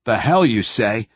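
understated irritated military retort; snapped retort. A narrator's reading. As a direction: snap back with a touch of anger. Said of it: "snapped a voice with a definite Webb accent".

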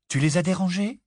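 high startled question; surprised exclaim. A narrator's reading. Mother shocked at her son, high exclamation. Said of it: "s'exclama Ginny".

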